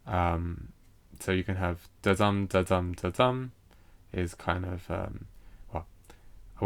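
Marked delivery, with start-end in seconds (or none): 2.0-3.5 repeated "da-dum" with the weight on "dum"; iambic rhythm. Shown as a demonstration. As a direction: tap out the iambs "da-dum, da-dum". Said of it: "it's an unstressed and stressed".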